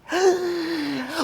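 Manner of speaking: high, theatrical gasp; horrified gasp. Acted. Cousin appalled at plastic talk, high mock-horrified gasp.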